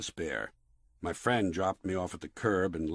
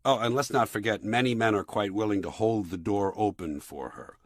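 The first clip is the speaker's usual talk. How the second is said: usual speaking voice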